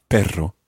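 "perro" with a trilled RR; rolled double R. Shown as a demonstration. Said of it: "double R".

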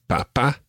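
papa said pa-PA, second syllable stressed; papa with a rising, stressed ending; stress on last syllable. Shown as a demonstration. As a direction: lean on the final syllable of papa. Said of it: "both have accents on the last syllable".

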